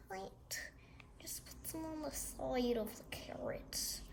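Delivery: whispered instructions. Real, not acted. Whispering while the friend glues the carrot. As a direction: hush it to a breathy whisper. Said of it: "You don't have to talk like that".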